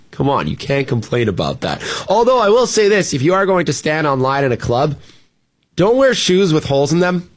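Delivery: usual speaking voice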